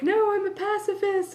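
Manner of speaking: high, pouty mock voice of a friend; mock Maddie. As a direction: squeak it out like a prim pacifist. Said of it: "Maddie's like".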